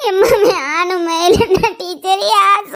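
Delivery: slow, tearful whine to mother; drawn-out crying plea. Acted. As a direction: plead through sobs, dragging the words. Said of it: "அழாத".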